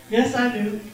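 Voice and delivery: ordinary talk, nothing marked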